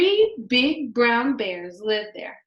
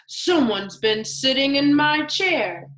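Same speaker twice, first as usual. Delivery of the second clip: lightly voiced gruff Daddy Bear, a bit louder; mock growl; 3 semitones higher, 5 dB louder than usual. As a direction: grumble it like the big father bear. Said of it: "he growled".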